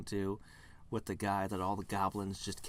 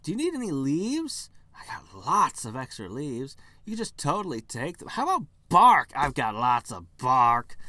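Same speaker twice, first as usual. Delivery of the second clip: pushy tree; 4 semitones higher, 10 dB louder than usual. Acted. Tree pushing its parts.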